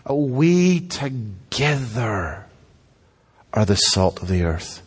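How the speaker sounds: "we together" drawn out, then "are the salt of the earth" dropped low; stress on together. Shown as a demonstration. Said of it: "this is a communal thing".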